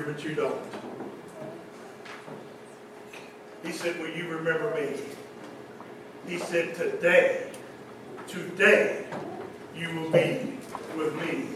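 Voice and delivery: soft, low, intimate retelling; hushed storytelling. A narrator's reading. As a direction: draw them in with a hushed voice. Quietly retells the two thieves.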